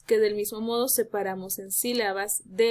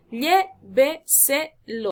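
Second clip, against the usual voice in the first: "lléveselo" slowly split, LLÉ-ve-se-lo, higher pitch; syllable by syllable; 3 semitones higher, 4 dB louder, much slower than usual. Shown as a demonstration. Teacher giving a sobreesdrújula example, syllable by syllable. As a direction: chop "lléveselo" into four slow syllables, stressing llé. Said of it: "Sílabas última".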